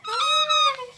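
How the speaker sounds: very high squealed hi; excited greeting. Real, not acted.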